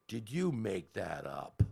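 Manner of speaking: loud, slow, booming teacher's question; drawn-out mock interrogation; Missus Wiley. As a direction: imitate a strict teacher demanding an answer. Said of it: "She had a fairly deep voice".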